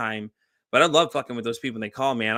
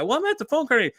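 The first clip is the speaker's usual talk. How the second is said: mock naive coworker; 12 semitones higher than usual. Imitating coworker on scam call.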